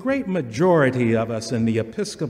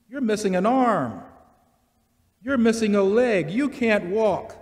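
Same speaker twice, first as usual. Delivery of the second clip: preaching at full force; 9 semitones higher, 6 dB louder than usual. Acted. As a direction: drive each judgment home, building. Preaching climax.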